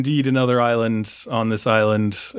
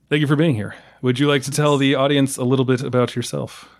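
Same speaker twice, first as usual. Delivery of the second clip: ordinary talk, nothing marked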